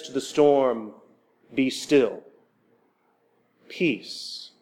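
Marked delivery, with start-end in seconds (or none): 1.5-4.5 calm command. Retold or imitated. Jesus commanding the storm.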